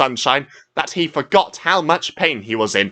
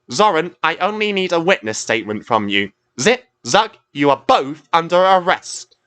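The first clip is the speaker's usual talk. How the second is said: higher, firm official lecture; stern Coast Guard; 8 semitones higher, 3 dB louder than usual. A narrator's reading. Coast Guard sternly scolds the two tugs, raised voice. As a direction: lay down the law to the culprits.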